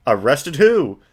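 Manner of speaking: ordinary talk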